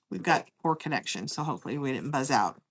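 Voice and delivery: talking with a dry mouth; parched, sticky-mouthed speech. Real, not acted. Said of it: "Sorry, I'm kinda dry mouth".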